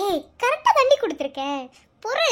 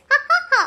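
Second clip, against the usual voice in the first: loud fake ha ha ha; high forced laugh; 11 semitones higher, 5 dB louder than usual. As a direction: laugh it off awkwardly. Awkward cover-up laugh. (செயற்கையான உரத்த சிரிப்பு)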